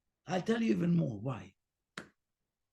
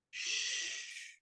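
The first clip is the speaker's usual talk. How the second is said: unvoiced soft shush; quiet "shh"; 13 dB quieter than usual. Shown as a demonstration. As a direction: hush the mind right after stopping it. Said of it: "Tell yourself".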